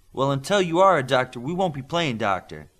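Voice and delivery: putting his foot down; mildly stern; firmly. A narrator's reading. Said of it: "Midas said firmly".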